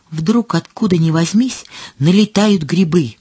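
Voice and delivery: ordinary delivery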